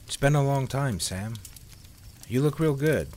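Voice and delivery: a hint of a bashful woman. A narrator's reading. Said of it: "Liz said bashfully".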